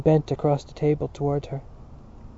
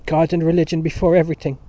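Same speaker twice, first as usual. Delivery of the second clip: fast, louder declaration; impassioned cry; 3 semitones higher, 7 dB louder, faster than usual. A narrator's reading. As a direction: cry it out with fervor. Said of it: "Dante cried".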